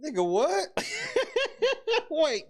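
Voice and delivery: high-pitched disbelief breaking into laughter; stunned and tickled, voice jumping high; incredulous laughing outburst. Real, not acted. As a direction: crack up in sheer disbelief. Baffled reaction to a tearful clip.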